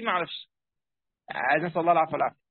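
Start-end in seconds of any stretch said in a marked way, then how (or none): none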